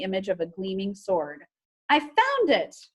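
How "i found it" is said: high, slow, loud discovery cry; triumphant. Acted. Lila calling to the misfits on finding the stone, triumphant. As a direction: announce the find in triumph.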